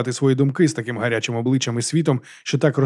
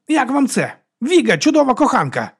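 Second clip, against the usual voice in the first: high, defiant announcement; excited high-pitched boast; exclaiming; 12 semitones higher, 4 dB louder than usual. A narrator's reading. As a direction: proclaim it to everyone, pitched high. Drunk monk proclaims his lover.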